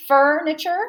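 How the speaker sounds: FURNITURE with a long, strong FUR; stress on first syllable. Shown as a demonstration. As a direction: say FURNITURE as FUR-ni-chur, as one option. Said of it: "The first one".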